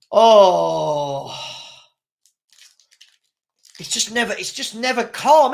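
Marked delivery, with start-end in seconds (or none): 0.1-1.3 a loud pained oh, dragged out; pained groan. Real, not acted. Fan groans at the on-pitch argy-bargy, loud pained oh. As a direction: groan like the match hurts.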